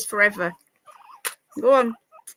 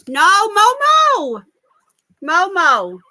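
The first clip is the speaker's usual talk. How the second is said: scolding the dog; 6 semitones higher, 10 dB louder, much slower than usual. Real, not acted.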